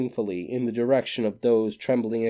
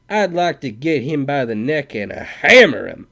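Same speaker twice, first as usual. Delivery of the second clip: loud, higher sympathetic outburst; groaning sympathy; 5 semitones higher, 10 dB louder than usual. A narrator's reading. Cowboy backing up Scully, loud angry outburst. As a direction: rage along with the old man. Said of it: "The cowboy groaned in sympathy".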